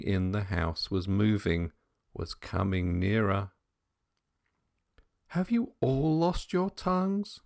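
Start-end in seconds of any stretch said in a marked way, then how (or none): 5.3-7.4 high, rising impatient question; a governess losing patience. A narrator's reading. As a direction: demand an answer from silent children. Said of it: "asked the governess impatiently".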